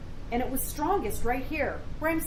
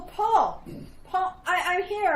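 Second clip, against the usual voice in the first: raised, higher answering call; calling back; 6 semitones higher, 5 dB louder, slower than usual. Acted. Wife answering her searching husband, calling back. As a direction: call out so he can find you.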